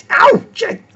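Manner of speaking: high, loud yelp of pain; mock yelp. Boy yelping after a pinch.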